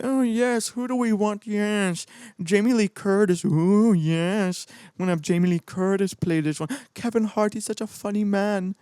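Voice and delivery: mocking old executives. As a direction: ham up a pleased, out-of-touch studio boss. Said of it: "people who think they know what they're doing".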